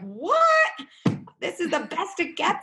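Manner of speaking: comic disbelieving adult; mock disbelief. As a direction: act stunned by the letdown.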